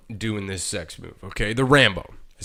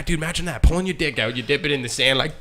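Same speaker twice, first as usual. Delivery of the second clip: ordinary delivery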